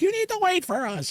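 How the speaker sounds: high-pitched mock parent call; imitated parent calling. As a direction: mimic a parent calling after running kids. Parents calling after running kids.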